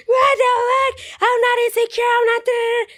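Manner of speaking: hammed-up furious coworker shrieking. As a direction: screech it like she's losing it. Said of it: "She got furious".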